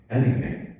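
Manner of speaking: plain reading voice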